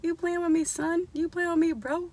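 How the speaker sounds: high, sassy mock challenge; challenging. Vlogger scolding her own shrinkage, high sassy challenge. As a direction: call out the hair like it's playing you. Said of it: "'cause I be like".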